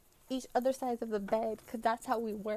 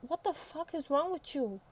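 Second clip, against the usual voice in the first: higher-pitched retold disbelief; exasperated scolding; 5 semitones higher than usual. Telling off a friend for shoe on counter, exasperated.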